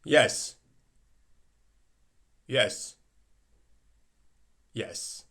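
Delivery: ordinary talk, nothing marked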